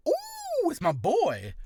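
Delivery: big high "Ooh" swooping into a delighted welcome; huge genuine greeting. Shown as a demonstration. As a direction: greet an old friend with real joy. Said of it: "it's the, like, huge, genuine".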